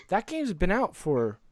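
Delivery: ordinary talk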